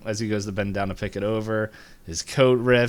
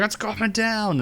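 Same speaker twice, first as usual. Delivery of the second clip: high-pitched warning cry; mock alarm; 12 semitones higher than usual. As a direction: imitate a man warning of disaster. Man warns of falling giant head.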